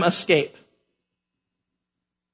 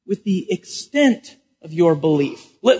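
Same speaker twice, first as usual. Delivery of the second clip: usual speaking voice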